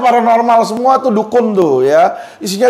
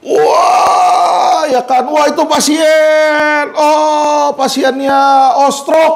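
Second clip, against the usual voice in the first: mock fortune-teller; 5 semitones higher, much slower than usual. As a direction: play a fake psychic shouting a diagnosis from afar. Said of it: "belum ngeliat orangnya dari jauh di terawang".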